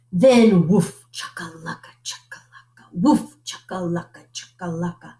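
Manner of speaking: woof then whispered chakalaka rattle; chanted sound effect. A narrator's reading. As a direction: make the rattle of the spinning cup.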